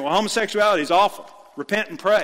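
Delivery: sarcastic impression of a pastor repeating himself. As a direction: spit out the same old line. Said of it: "And you keep saying".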